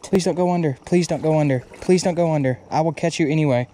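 normal talking voice

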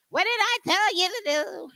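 put-on high, stern grandma telling off the kids; mock grandma scolding. Grandmother looking down at her grandchildren, mock stern scolding. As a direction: play the strict grandmother checking on them.

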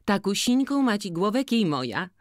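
put-on high, loud, bright-eyed girl complaining of the seller; lively village girl. Jagna asking Boryna to judge the cap, high and loud. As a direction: pitch it up and call out to Maciej.

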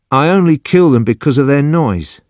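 high, pleading self-justification; defensive. Acted. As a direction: protest in a simple man's high voice. Judson justifies himself.

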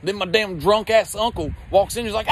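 normal talking voice

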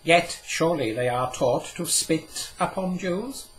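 Cadmiel, a touch amused. Acted. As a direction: play the learned old man, gently amused. Cadmiel's voice in the story.

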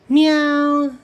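high, loud cat meow; mock him with a kitten cry. Owl mocking the lion with a high meow. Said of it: "You sound more like a house cat".

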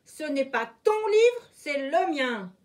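sharp high indignant retort; fox retorting. A narrator's reading. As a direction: snap back that the book is yours. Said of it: "riposta le renard".

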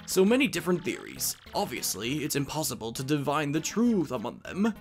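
scholarly storyteller with lively pitch swings; professor explaining lore. Acted. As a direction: lay out the legend like a professor.

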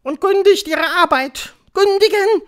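high, loud orders; thundering witch. A narrator's reading. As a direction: screech commands at your followers. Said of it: "donnerte die Hoch". (donnernde Befehle, hoch und laut)

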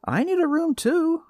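high, loud sharp retort; snapped back. A narrator's reading. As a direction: snap it back indignantly. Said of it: "I snapped back".

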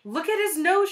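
high, loud cry; excited schoolkid yell; shouting. A narrator's reading. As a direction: shout it out to the whole class. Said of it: "shouted Eric Bacon".

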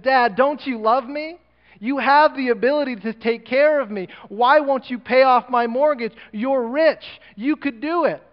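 high, loud, slowed angry outburst; a son shouting his anger at his rich father. Acted. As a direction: yell it at his father, hurt and furious. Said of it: "he got so angry at his dad".

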